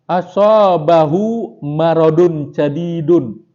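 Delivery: slow, measured reading of Arabic text; kitab recitation. Acted. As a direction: intone each phrase slowly like reading a kitab aloud.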